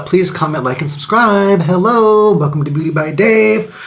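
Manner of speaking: high, loud hostlike greeting; bright YouTube-host welcome; excited intro. Acted.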